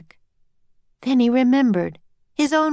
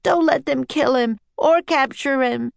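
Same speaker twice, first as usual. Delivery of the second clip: mild tearful plea, pitched up; sobbing; 6 semitones higher than usual. A narrator's reading. Annie begging Henry to intervene, mildly sobbing. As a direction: implore him with a touch of tears. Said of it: "she sobbed".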